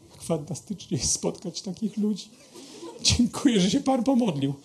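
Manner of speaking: high, soft imitation of tearful gratitude; tearfully moved. Weeping listener thanks the pastor for praying, moved imitation. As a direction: thank him as if overcome with emotion. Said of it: "jego żona spłakana, łapie mnie za rękę".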